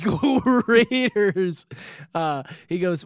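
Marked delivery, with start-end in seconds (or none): 0.0-1.7 high, loud, drawn-out mock cheer. Joking reply to airport guy's greeting, drawn-out mock cheer. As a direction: stretch it out like a hammed-up fan chant.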